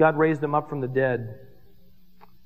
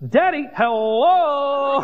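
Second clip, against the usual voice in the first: Daddy, hello stretched slow and higher, a little louder; drawn-out daddy hello; 8 semitones higher, 5 dB louder, much slower than usual. Retold or imitated. Daughter leaning over to her distracted dad, slow and higher. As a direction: drag it out to get his attention. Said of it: "she leans over to me and goes".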